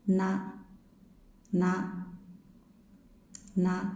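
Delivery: "Na" hummed through the nose; nasal na. Shown as a demonstration. Teacher demonstrating the nasal na. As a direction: let na ring nasal. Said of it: "Nasal sound".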